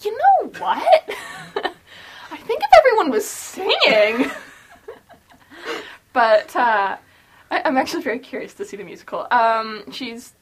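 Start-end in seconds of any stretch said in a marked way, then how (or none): 0.0-4.4 hammed-up sudden idea, cracking up; laughing mock epiphany. Host imitating a reader imagining a musical, laughing. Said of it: "you don't go".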